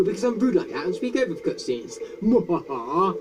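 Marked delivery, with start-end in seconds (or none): 2.2-3.1 slow villain cackle; hammy mwahaha; evil laugh. Acted. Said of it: "that was an evil laugh".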